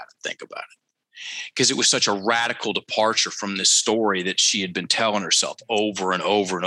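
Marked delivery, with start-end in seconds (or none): 0.0-1.5 whispering; whispered. Real, not acted. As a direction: breathe the words out unvoiced. Drops to an unvoiced aside.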